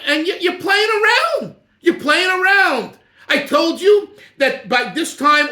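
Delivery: high, loud mock rebuke; scolding Trump imitation. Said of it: "he says".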